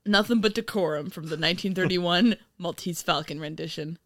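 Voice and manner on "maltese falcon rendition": ordinary talk, nothing marked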